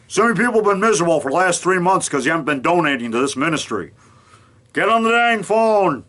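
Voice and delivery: fast then slowing, scolding TV preacher; preacher impression. Mimicking Gene Scott scolding his viewers, preacher voice. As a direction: nag viewers to phone in and donate. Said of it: "Gene Scott up there, and he'd say".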